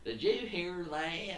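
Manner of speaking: high, drawn-out mock New Jersey voice; mock New Jersey accent. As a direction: stretch it slowly in a put-on New Jersey accent. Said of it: "how people from New Jersey sound".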